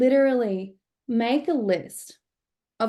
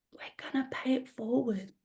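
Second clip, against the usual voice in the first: hushed aside; 3 semitones higher, 8 dB quieter than usual. Retold or imitated. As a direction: keep it hushed so the stranger can't hear. Secretly telling friend at table.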